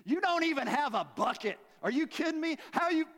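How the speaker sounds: high, indignant sassy woman; sassy incredulity. Acted. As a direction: scoff loudly at an absurd offer. Acts out woman's incredulous retort to Jesus.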